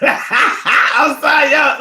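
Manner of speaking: high, laughing apology. Real, not acted. Apologizing to listeners after his parody, laughing. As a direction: apologize through a burst of laughter.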